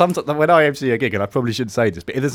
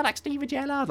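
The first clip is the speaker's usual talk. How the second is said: high, softer mock Scouse; caricatured Scouse accent; Stevie Gerrard; 12 semitones higher, 9 dB quieter than usual. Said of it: "then they do Stevie Gerrard".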